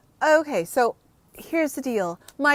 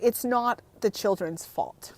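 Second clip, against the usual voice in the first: usual speaking voice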